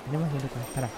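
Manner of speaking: low, very quiet aside; hushed. Acted. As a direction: drop right down so the seller can't hear. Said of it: "No lo digas muy fuerte". (en voz muy baja, disimulando)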